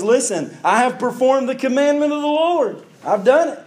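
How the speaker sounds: high-pitched eager announcement; excited, exclaiming. Acted. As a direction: play Saul proudly reporting in, pitch jumping up. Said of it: "with an exclamation point".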